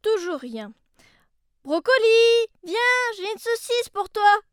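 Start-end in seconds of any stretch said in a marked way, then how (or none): 1.6-4.4 high loud coaxing call. Acted. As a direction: lure the dog with a shout. Calling dog with lure.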